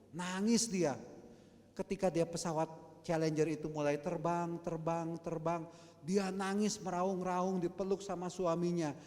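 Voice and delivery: loud, high, driving sermon storytelling; fiery storytelling from the pulpit; impassioned preaching. Acted. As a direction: preach it out to the whole church. Preacher tells Challenger story loudly.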